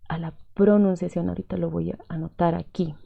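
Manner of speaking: ordinary talk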